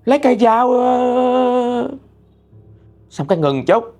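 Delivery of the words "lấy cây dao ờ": high-pitched acted alarm, last sound held long; crying out while sleep-talking. Sleep-talking mother sees a ghost and calls her husband, acted panicked cries. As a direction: act out a frightened mother calling for help in her sleep. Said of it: "xong lát cái mẹ la làng quá trời luôn".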